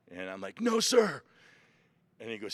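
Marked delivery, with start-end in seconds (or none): 0.6-1.2 high, loud retold refusal; defiant recruit. Recruit refuses the drill instructor, defiant shout.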